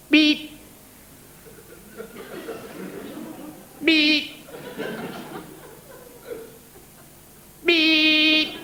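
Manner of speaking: separate, sustained horn beeps on one note; imitated car horn. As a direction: honk like a car behind. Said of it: "bang their horns all the time".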